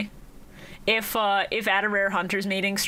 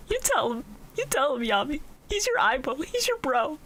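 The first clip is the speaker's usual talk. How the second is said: higher-pitched encouragement; cheering him on; 7 semitones higher than usual. Retold or imitated. Fan cheering Yami's speech on, higher and supportive. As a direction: egg him on in a raised pitch.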